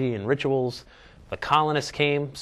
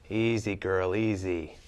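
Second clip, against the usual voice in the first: soothing a scared dog; 4 semitones lower, 4 dB quieter, slower than usual. Pretend. Calming the animal again.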